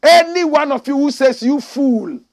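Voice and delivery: shouted high and loud; preacher roaring at the congregation; fiery preaching. Acted. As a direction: hammer the verse home. Full-force preaching of the verse.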